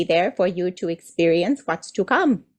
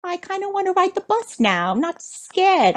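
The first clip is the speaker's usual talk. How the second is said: put-on eager child voice; 12 semitones higher than usual. A child tells the grown-ups, high and eager. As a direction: pipe up like a kid who's outgrown the fear. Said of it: "you'll say".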